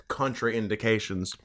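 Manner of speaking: ordinary talk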